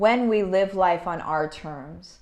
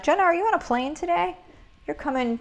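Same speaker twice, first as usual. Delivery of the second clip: ordinary talk, nothing marked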